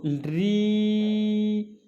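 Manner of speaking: ल्री with the final vowel held long and steady; prolonged pluta vowel. Shown as a demonstration. Teacher showing students the extra pluta vowel, ल्री drawn right out. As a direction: stretch the ई of ल्री over three beats. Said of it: "इस तरह से इनमें तीन मात्रा काल का".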